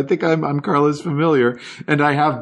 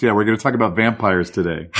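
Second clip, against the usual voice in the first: ordinary talk, nothing marked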